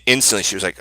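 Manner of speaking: normal talking voice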